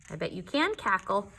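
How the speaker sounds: ordinary delivery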